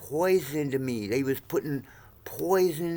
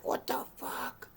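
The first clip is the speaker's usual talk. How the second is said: whispered disbelief; 13 dB quieter, slower than usual. Retold or imitated. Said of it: "I'm like".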